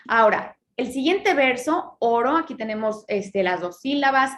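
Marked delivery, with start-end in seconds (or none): none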